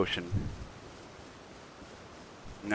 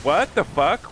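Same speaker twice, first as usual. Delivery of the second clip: shocked outburst; 7 semitones higher, 4 dB louder than usual. Real, not acted. Gamer surprised by teammate.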